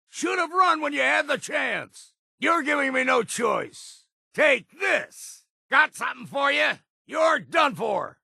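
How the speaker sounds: high barking war cry; combat threat shout. Acted. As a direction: roar it at the enemy mid-fight. Combat threats mid-fight.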